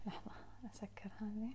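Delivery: quick unvoiced whisper to herself; whispered aside. Real, not acted. Speaker muttering to herself mid-talk, whispered. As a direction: breathe it under your breath as an aside. Aside while closing something.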